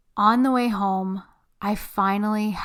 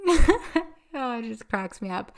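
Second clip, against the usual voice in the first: laughing; 3 semitones higher, slower than usual. Real, not acted. Laughing aside.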